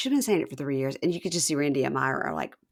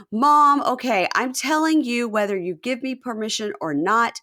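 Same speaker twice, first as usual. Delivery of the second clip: high, loud defiant announcement; firm declaration; 5 semitones higher, 4 dB louder than usual. Retold or imitated. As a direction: stand up to mom at last. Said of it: "And he goes".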